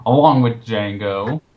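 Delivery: ordinary talk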